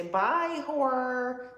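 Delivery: high mock dismissive goodbye; sassy farewell. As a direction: wave them off in a sing-song.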